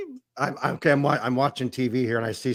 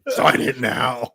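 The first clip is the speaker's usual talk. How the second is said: creepy villain voice; 5 dB louder, much slower than usual. Retold or imitated. Playing Saw villain car salesman.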